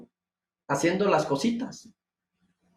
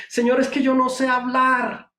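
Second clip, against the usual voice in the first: higher whining excuse; pleading Moses; 8 semitones higher, 3 dB louder than usual. Acted. Preacher voicing Moses to God, high whining excuse. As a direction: whine out the excuse, pitched up.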